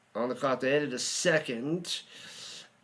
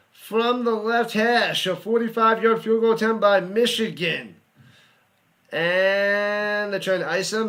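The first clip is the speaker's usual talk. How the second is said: higher, louder announcer voice, stretched at the start; announcer call; 4 semitones higher, 5 dB louder than usual. Acted. Fan announcing the game-deciding field goal, louder announcer call. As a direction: set up the kick like a broadcaster.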